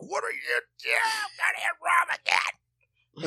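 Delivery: high, strained parental outburst; retold yelling. Dad retells scolding his daughter for making a mess, yelling. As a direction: squawk it out in mock exasperation. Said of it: "you yelled at me".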